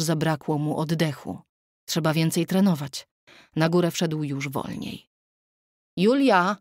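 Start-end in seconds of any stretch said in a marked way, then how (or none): 6.0-6.6 calling a name through the flat, louder and higher; a searching call of his wife's name. A narrator's reading. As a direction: call her from the doorway. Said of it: "zawołał od progu".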